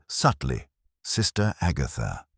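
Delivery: ordinary delivery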